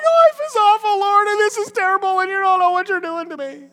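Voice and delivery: hammed-up high whining lament; whiny complaint to God. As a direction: wail like a child in a tantrum. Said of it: "And we're going like".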